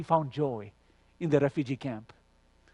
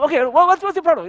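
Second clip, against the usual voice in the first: high, loud, agitated questioning; retold exasperation, voice jumping up and pressing; perturbed; 12 semitones higher, 7 dB louder than usual. Perturbed caller demanding to know the pastor's problem, agitated retelling. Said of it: "I'm just really perturbed with all this".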